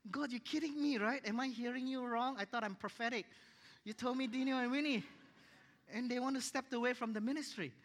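high, pleading disbelief; shocked plea to God. Retold or imitated. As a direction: complain to God, pitch way up in shock. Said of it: "I got a shock".

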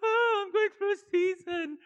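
mocking a self-pitying believer, full fake cry; hammed-up wailing. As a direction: wail it like a melodrama. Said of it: "people go".

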